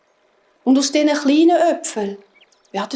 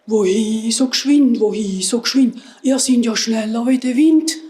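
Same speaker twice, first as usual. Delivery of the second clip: grunting wild boars. A narrator's reading. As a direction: grunt the words out like a boar. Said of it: "und grunzen".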